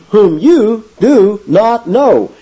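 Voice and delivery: "you" hit high and hard, every word spaced out; slow, forceful quote leaning on "you"; emphatic you. Retold or imitated. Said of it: "is emphatic".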